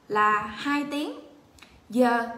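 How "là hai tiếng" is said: usual speaking voice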